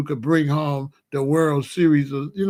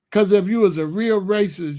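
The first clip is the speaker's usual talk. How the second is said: ordinary talk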